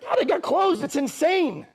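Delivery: high, loud, half-laughing; loud amazed exclaim. Retold or imitated. Sits back, amazed.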